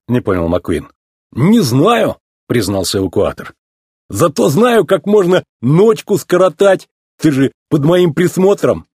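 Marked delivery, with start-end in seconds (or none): 1.3-2.2 high, drawn-out admission; simple-minded tow truck. Acted. Mater admits to the racer, simple tow truck. 4.1-8.9 high, animated yokel voice; mischievous tow truck. Acted. Mater suggests passing the night together, mischievous tow truck.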